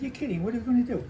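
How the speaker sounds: high, startled disbelief; surprised friend. Retold or imitated. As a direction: voice jumps up in surprise. Friend's surprised reply retold.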